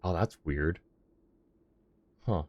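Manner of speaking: ordinary talk, nothing marked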